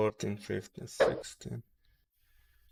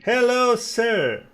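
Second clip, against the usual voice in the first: playful greeting; 12 semitones higher, 9 dB louder, slower than usual. Acted.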